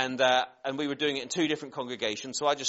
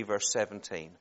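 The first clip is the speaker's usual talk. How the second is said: usual speaking voice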